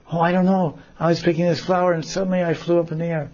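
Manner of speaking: put-on high, fast flustered girl; high quick rattled Radha. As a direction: blurt out what happened. Radha answers from tree.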